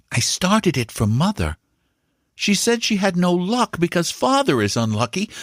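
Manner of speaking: put-on high, eager young boy's voice; a boy boasting to his uncle; boy Paul. A narrator's reading. Young Paul assures his uncle and Bassett, eager and high.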